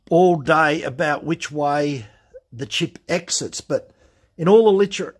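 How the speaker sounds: usual speaking voice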